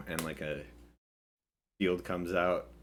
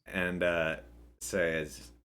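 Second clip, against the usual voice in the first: ordinary delivery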